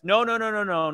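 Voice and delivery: ordinary talk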